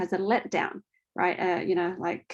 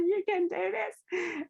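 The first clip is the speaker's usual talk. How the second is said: higher, slow and softer encouragement; reassuring encouragement; 10 semitones higher, 5 dB quieter, slower than usual. Retold or imitated. Friend retells urging the husband to catch his baby, slow and reassuring. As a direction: gently coax a frozen father.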